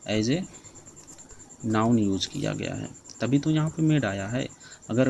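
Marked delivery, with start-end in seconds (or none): 0.1-2.9 slow dictation. Shown as a demonstration. Slowly dictating the rule.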